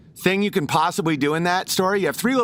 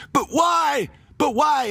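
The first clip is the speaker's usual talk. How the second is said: dying pig's cry; 9 semitones higher, slower than usual. Retold or imitated. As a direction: cry out betrayed, pitched high. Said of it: "He's like".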